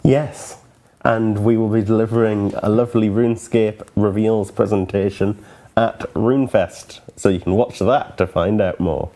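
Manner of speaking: ordinary talk, nothing marked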